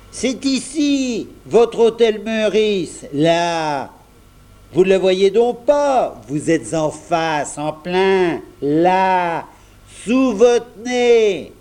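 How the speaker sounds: put-on boy losing patience, stressing every word; exasperated shop boy. A narrator's reading. Jeannot exasperated, pointing. (garçon exaspéré, voix haute et appuyée)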